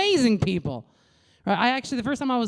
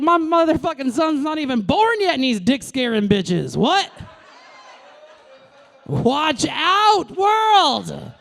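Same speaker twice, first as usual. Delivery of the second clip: loud, high proud bragging; proud mock boast; 8 semitones higher, 4 dB louder, slower than usual. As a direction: crow it to the whole world. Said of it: "I was like".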